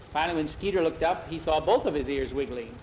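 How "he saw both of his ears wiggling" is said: plain reading voice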